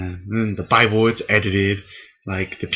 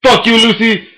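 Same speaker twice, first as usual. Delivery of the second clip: high, loud exasperated shout; annoyed yell at the bird; 12 semitones higher, 8 dB louder than usual. Real, not acted. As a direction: snap at the noisy pet. Yelling at his annoying bird.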